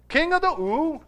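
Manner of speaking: high-pitched mock incredulous question; puzzled peasant woman. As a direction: imitate a baffled woman querying the king. Peasant woman questions Arthur's title.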